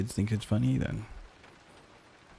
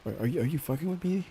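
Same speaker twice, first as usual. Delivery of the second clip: rising disbelief, pitched up; a kid who can't believe it; mock shocked kid voice; incredulous; 5 semitones higher than usual. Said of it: "I was like".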